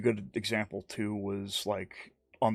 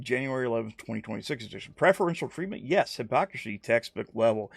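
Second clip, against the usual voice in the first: normal talking voice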